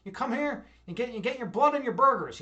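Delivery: playing up a thick Chicago accent. Shown as a demonstration. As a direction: lay on the South Side Chicago twang. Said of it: "people talk like this a lot".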